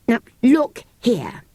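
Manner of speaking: slow, deliberate start; slowly. Acted. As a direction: weigh each word, slow. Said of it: "said Cyril slowly".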